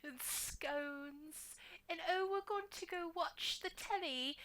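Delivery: high-pitched caricature of posh British; clenched, chest-out posh British put-on; mock posh British accent. American parodying what Americans think Brits sound like, high posh British accent. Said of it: "British accents is that whole".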